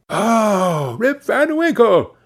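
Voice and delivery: high-pitched exclamation of recognition; exclaiming. A narrator's reading. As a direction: exclaim like bystanders who suddenly recognise the name. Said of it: "exclaimed two or three".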